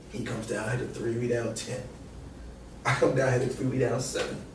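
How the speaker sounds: ordinary delivery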